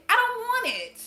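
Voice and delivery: emphatic refusal. Real, not acted. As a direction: insist you want none of it. Rant about love not being pain.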